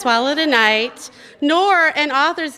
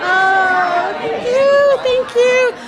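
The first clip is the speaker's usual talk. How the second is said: delighted thanks; 12 semitones higher, 5 dB louder, much slower than usual. Real, not acted. Delighted thanks amid laughter.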